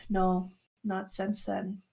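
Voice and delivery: soft, slow, understated reply; half-breathed. Acted. Gris answers whether she still dreams, quietly on half a breath. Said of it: "lets out half a breath and says".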